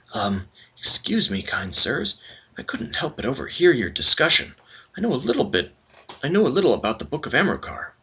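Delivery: approach nervously, almost apologetic; timid servant. Acted. Small servant nervously offering the party information, hesitant.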